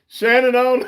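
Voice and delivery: laughing excitedly at a comment. Real, not acted. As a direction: burst out laughing mid-word.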